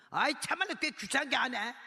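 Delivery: fed up with this pestering woman; annoyed guard. Retold or imitated.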